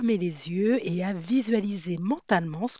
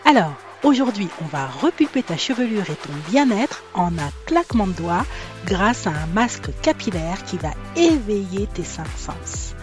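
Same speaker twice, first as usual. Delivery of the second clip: soothing intro read; 5 dB louder than usual. Acted. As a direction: read it like a relaxing spa ad. Soothing ad-style intro over music.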